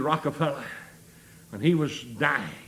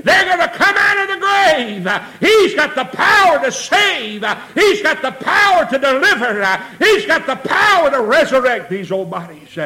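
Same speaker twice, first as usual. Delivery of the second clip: full-force preaching; 6 semitones higher, 8 dB louder than usual. Real, not acted. Preacher proclaiming the resurrection, full-force shouted preaching. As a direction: hammer each phrase out at full volume.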